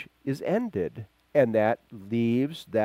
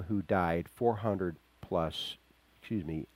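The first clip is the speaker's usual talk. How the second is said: ordinary delivery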